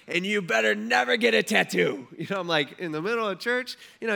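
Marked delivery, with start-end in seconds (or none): 0.1-2.1 imitating a mad preacher, louder; hammed-up scolding from the pulpit; mock angry preacher. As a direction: point and scold like a fiery preacher. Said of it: "he's, like, mad".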